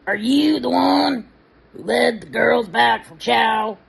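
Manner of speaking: high, slow, put-on male voice; Pritchard's voice. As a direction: mimic the commander's odd voice. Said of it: "this is what he sounded like when he talked".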